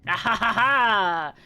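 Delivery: big drawn-out belly laugh; loud slow laugh. Real, not acted. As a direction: throw your head back laughing.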